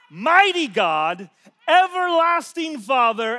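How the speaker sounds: slow reading that swells to a loud proclamation; scripture reading building. Real, not acted. Reads Isaiah verse, building.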